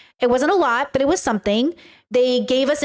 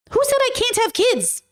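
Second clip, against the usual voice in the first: high, indignant challenge; indignant retort; 11 semitones higher than usual. Real, not acted. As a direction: demand it in a raised pitch. Repeats retort to the rumor.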